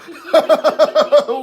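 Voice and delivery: bursting into a high laugh; a real amused laugh. Real, not acted. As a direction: laugh out at the teasing. Mocking JD's Pello.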